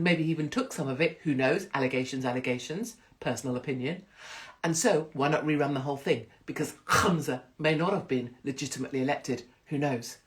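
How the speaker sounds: ordinary talk, nothing marked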